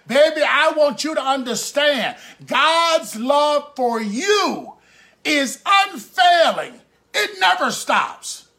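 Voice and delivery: slow, emphatic pulpit proclamation; fervent preaching. Real, not acted. Preacher assuring a lonely listener of God's love, fervent preaching. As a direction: press each phrase on the listener.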